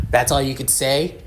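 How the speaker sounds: irritated question, normal pitch; a first, contained jab of irritation; annoyed. Acted. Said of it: "ticked off mark".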